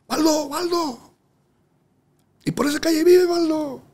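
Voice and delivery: put-on a kid tugging and begging for the song; imitating eager fan. Fan tugging at his pants.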